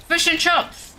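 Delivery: put-on "Fish and chops" in a New Zealand accent, chips said like chops; mock New Zealand accent. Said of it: "Frick, I can't even say it".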